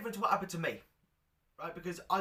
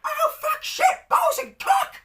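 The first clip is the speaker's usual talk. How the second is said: very high, loud squeaky swearing; a tiny boy shrieking curses; squeaky child voice; 12 dB louder, slower than usual. Retold or imitated. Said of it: "that is how I sounded".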